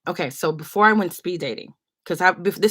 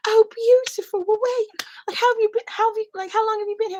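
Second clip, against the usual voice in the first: much higher and louder, gushing kind lady; sweet old lady; 5 dB louder than usual. Retold or imitated. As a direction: squeal with delight like a doting auntie. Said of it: "She's like".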